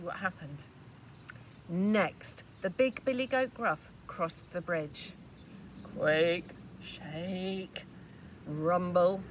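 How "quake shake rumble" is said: dramatic rumble. A narrator's reading. Big goat's heavy steps.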